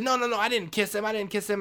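high, loud protesting; frantic denial. Retold or imitated. Maria denying the kiss to Tito, frantic loud protest. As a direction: deny it over and over at a shout.

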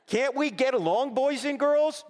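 high, loud sing-song kiddie-host voice; mock kiddie-show host. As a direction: parody a children's TV host, high and sugary. Mocking a soft wimpy Jesus.

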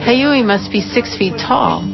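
usual speaking voice

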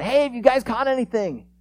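acted friendly shout from shore; calling out. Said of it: "Jesus calls out".

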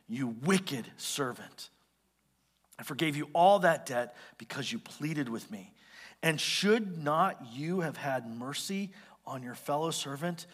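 low, slow, measured reproach; stern rebuke. Acted. Master confronting the unforgiving servant, stern rebuke.

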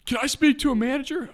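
high, loud demand for the manager; screaming customer. Retold or imitated. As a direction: screech it as an outraged caller. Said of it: "Immediate screaming".

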